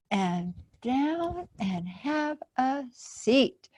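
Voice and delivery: plain reading voice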